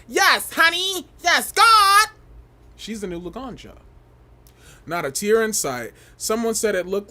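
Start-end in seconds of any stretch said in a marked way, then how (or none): 0.1-2.1 high loud camp cheer; mock diva cheer. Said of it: "I wanna see this bitch go everywhere like".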